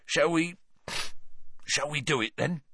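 shaky voice. Acted. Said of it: "in a shaky voice".

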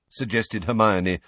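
ordinary delivery

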